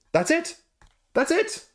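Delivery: high, rising disbelief at how little was offered; incredulous. Real, not acted. Reviewer mocking the thin campaign page, incredulous. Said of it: "You can see why I'm so emphatic".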